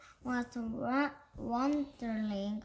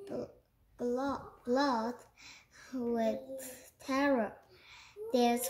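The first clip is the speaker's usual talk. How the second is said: ordinary delivery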